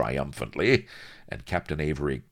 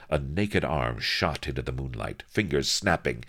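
usual speaking voice

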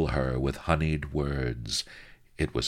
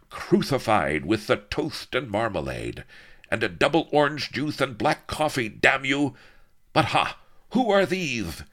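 ranting villain; 10 semitones higher, 5 dB louder than usual. Acted.

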